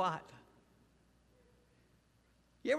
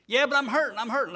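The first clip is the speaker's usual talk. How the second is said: louder, higher, whining I'm hurting; whiny complaint; 6 semitones higher, 9 dB louder than usual. Acted. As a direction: cry out like a hurt child. Complainer answers back.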